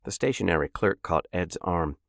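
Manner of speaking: usual speaking voice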